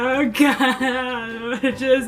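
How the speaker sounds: put-on high, slow, whiny drunk wailing; wailing drunk guy. Drunk man wailing that he wants home. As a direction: sob and whine like a very drunk guy. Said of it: "my best interpretation of what Drunk Bobby was like".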